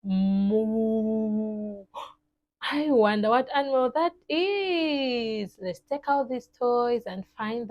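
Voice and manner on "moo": long, drawn-out moo; cow moo. Acted. Teacher hinting at the hidden animal, a long moo. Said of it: "there is an animal that goes".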